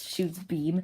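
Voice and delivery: usual speaking voice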